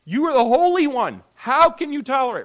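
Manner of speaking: high, loud pleading cry; the prophet crying to God, loud and high; Habakkuk's loud plea. Acted. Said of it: "It says".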